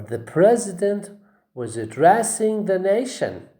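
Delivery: "addressing" stressed on DRESS; second-syllable stress. Shown as a demonstration. Teacher gives another verb example, stressing DRESS in "addressing". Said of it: "you say address".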